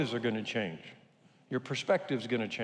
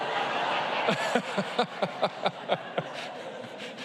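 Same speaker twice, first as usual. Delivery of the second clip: breathy laugh; 7 semitones higher than usual. Real, not acted. Lights dimming mid-sermon.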